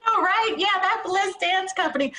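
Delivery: high, squeaky mock of her friend's proud reply; laughing friend mimics the dance director's bragging; teasing imitation. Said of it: "She's like".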